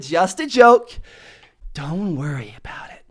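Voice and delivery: hushed, breathy second half; calm him with a near-whisper. Retold or imitated. Telling the customer it was a joke, whispering. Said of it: "I lowered my tone".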